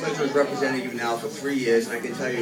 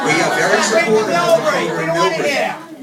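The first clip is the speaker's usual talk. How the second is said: forceful raised voice, much higher and louder; loud insistence; 13 semitones higher, 10 dB louder than usual. Real, not acted. Representative cutting off hecklers at a hearing, loud and forceful. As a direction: shout over the interruption, firm and loud. Interrupted while speaking at public hearing.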